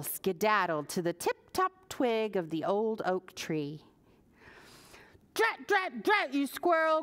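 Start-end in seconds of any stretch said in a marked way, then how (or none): 5.3-7.0 high, angry growl at the squirrel. Acted. Furious Pearl yelling at the squirrel, high and angry. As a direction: spit it out at the pest. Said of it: "growled Pearl".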